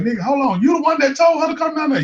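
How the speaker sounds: squeaky high, fast disbelief; disbelieving Ken. Retold or imitated. Friend Ken asking if he sent her, high incredulous squeak. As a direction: shoot way up in pitch, rushing the question. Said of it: "Ken was like".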